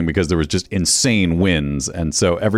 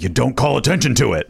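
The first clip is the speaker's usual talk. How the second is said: lightly voiced old-school broadcaster, higher pitched; 6 semitones higher than usual. Old school broadcaster scolding about calling attention, lightly voiced. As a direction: put on a hint of the old broadcasting pro. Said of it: "old school broadcaster who's like".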